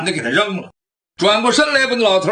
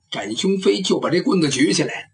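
usual speaking voice

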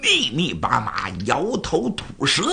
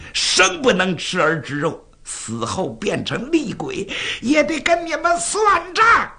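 condemned maid hurling curses at tyrants; angry cursing; 5 semitones higher than usual. Acted. Doomed palace maids cursing the king on the tower, angry cursing. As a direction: cry out a vengeful curse, rising to a high finish. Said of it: "就骂开了".

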